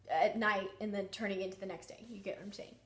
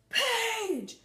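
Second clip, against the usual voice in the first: a sudden call of the name, much higher and louder; retold man's yell; 10 semitones higher, 4 dB louder than usual. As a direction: call the name out sharply, as the voice in the house did. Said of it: "and, like, yelled it".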